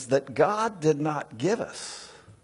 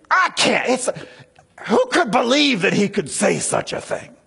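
mock indignance; 5 semitones higher than usual. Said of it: "It's an almost indignance".

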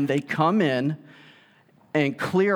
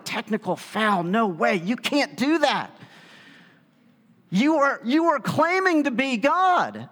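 mock outraged objection; 8 semitones higher than usual. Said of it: "they're like".

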